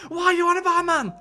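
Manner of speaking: high, far-off Geordie voice; mock Geordie presenter; Geordie accent. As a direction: say it like Dec in Geordie. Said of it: "I can hear his accent as saying".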